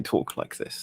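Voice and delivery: a touch of caricatured British accent. Shown as a demonstration. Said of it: "always feels a little bit stereotypical".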